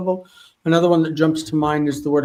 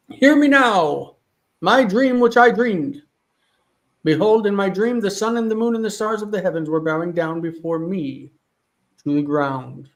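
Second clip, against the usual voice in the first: dramatic scripture reading; 4 semitones higher than usual. Acted. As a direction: declaim it like Joseph telling his dream. Reads Joseph's dream speech aloud.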